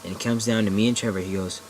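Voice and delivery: usual speaking voice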